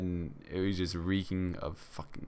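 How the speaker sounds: ordinary talk, nothing marked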